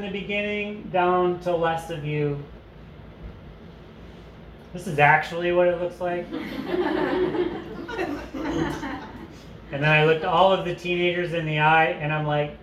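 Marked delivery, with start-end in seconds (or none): 6.3-9.3 high, bright real laugh; burst of laughter. Real, not acted. Laughs at her own slide.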